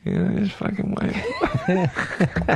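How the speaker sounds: drawn out through helpless laughter; slow, winded, laughing words; barely enough breath. Real, not acted. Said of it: "you barely have enough breath".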